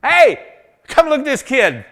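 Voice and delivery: loud high jeering call to the others; mocking call. Jebusite calling others to laugh at David. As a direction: call the crowd over to laugh.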